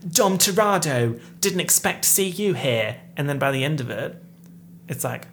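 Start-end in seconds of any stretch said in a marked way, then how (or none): 0.1-3.0 put-on big, loud, higher Cockney accent; Helen Mirren's Cockney. Vlogger showing how the actress's scene starts, strong Cockney accent. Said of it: "It starts off with".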